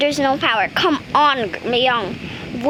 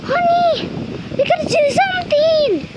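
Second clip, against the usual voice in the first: very high, fast, frantic pretend mom; high-pitched worried pretend mom; panicked doll mom; 13 semitones higher than usual. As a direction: squeal it like a frightened toy mother. Doll mother panics at power cut.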